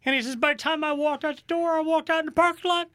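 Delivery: put-on high, sobbing little boy voice; crying little kid. Retelling how the lost kid sobbed out his story.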